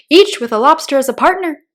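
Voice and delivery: loud, high exclamation; excited cry. Acted. Gryphon echoes the Mock Turtle, crying out loud. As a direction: cry it out with delight. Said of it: "cried the Gryphon".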